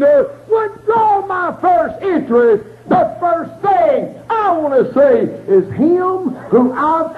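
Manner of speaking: rising into a sung whoop, cry in the voice; preaching. Acted. Preacher whooping to the congregation, crying out. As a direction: preach it, building to a tuneful shout.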